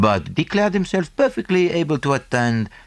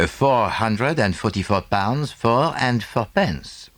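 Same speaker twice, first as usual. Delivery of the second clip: put-on slow, precise Belgian-French accented English; a lightly voiced fussy Belgian detective; Poirot, French accent; 3 semitones higher, slower than usual. Poirot speaks, narrated dialogue.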